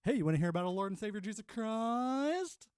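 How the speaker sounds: high, loud, hammed-up missionary greeting. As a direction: pitch salvation to a stranger, drawing out the last word.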